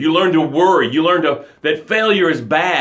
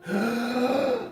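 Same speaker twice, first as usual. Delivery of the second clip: gasp; 6 semitones higher, 10 dB quieter than usual. Pretend. Gasping after rescue.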